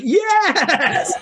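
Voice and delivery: high, delighted squeal of yes; excited yes. Retold or imitated. Richard Simmons answers the oldies question, squealing yes. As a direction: burst out thrilled. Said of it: "And Richard's like".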